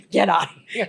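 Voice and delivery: laughing through the line. Retold or imitated. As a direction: say it while laughing. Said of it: "He's like".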